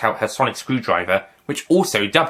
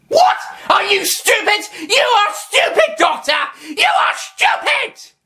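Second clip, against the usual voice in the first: furious shout; 4 dB louder than usual. Acted. Master insults the Doctor.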